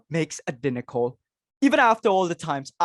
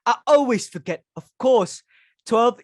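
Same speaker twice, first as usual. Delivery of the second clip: scoffing; 7 semitones higher, 4 dB louder than usual. Acted. Mother scoffs at seating rule.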